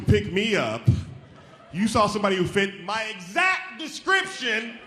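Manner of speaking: incredulous, emphatic telling-off; indignant confrontation. Acted. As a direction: spell out the absurdity to the offender. Acts confronting the woman.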